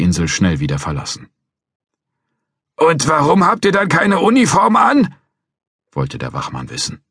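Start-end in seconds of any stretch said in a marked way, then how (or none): 2.8-5.1 put-on a cranky old guard threatening to call police; old watchman.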